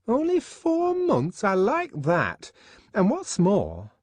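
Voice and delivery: Fanny aghast at her friend's lapse; scandalised disbelief. A narrator's reading. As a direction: drag out the shock at four months. Fanny scandalised, much higher.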